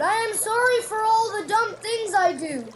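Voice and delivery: high, loud begging apology; pleading. A narrator's reading. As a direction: beg a friend's forgiveness. Toad begs forgiveness.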